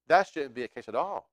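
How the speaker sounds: usual speaking voice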